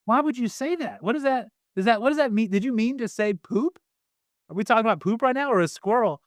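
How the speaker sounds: high-pitched run of baffled questions; acted puzzlement. Dad questioning his six-year-old son, mock puzzlement. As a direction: play a bewildered dad, pitched well up.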